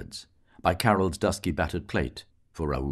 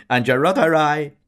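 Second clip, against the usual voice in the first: high, very loud laughing shout; a barmaid shouting a joke back; 12 semitones higher, 13 dB louder than usual. A narrator's reading. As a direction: yell the punchline.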